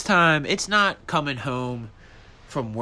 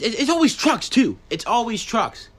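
exasperated; 10 semitones higher, 4 dB louder than usual. Real, not acted. Complaining about trucks.

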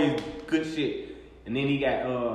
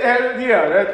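normal talking voice

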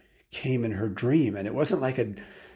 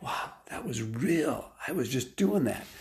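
hushed amazement; 3 semitones higher than usual. Retold or imitated. Retold waking amazed from dream.

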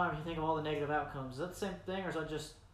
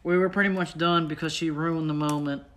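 ordinary talk

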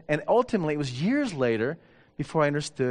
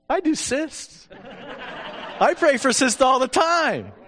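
cocky; 11 semitones higher, 5 dB louder, slower than usual. Retold or imitated. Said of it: "I thought".

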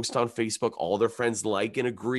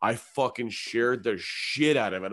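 ordinary talk, nothing marked

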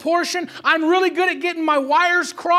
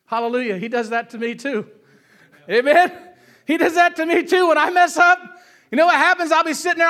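ordinary talk

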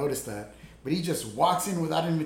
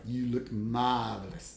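lower, slow, schmoozing "marvelous"; slow smarmy compliment; 6 semitones lower, 4 dB quieter than usual. Retold or imitated. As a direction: purr it like a smooth talker. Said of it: "that's how he would do it".

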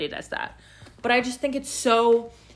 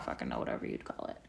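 much lower, softer muttered aside; low mumbled aside; 15 dB quieter, faster than usual. Real, not acted. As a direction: drop way down and mumble it off. Self-mocking low aside.

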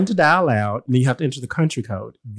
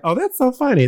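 higher, light laughing quip; retold amused remark; 10 semitones higher than usual. Recalling a lighthearted joke to her cohost, higher quip. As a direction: toss it off as a joke. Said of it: "and I was like".

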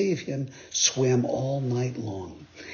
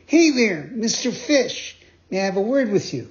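Pinocchio calling; 7 semitones higher than usual. Acted. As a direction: call out brightly, high and polite. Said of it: "the marionette said to him".

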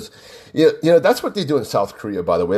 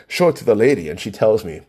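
usual speaking voice

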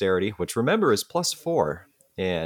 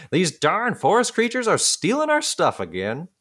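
grumpy scientist voice; 11 semitones higher than usual. Acted.

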